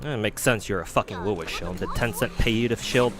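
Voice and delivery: ordinary talk, nothing marked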